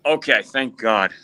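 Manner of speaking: usual speaking voice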